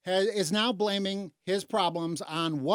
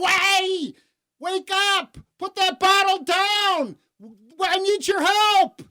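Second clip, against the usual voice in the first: high, loud plea to wake someone; urgent mock cry for help; desperate shouting; 12 semitones higher, 8 dB louder, slower than usual. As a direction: yell like you're banging on a car window. Acts out victim banging on car window.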